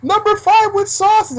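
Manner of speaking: high, loud correcting shout; furious customer shouting correction; angry yell. Retold or imitated. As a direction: bark the order back at the clerk. Old customer yelling at clerk.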